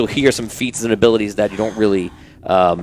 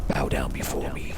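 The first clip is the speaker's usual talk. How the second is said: hissing whisper with reverb; whispered alien hiss; squid voice; 7 semitones lower, 11 dB quieter than usual. Acted. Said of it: "that's my squid voice".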